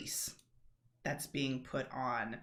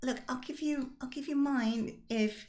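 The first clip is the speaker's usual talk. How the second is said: high-pitched conspiring game character; Rosalind, bargaining; 8 semitones higher than usual. Acted. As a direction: propose a trade in a high character voice.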